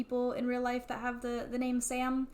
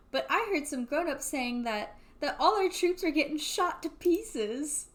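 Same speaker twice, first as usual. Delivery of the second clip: put-on higher, slow childlike worry; little kid voice; 5 semitones higher, 3 dB louder than usual. Little brother telling Sam the rumour, higher childlike voice. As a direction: play the boy repeating grown-up gossip.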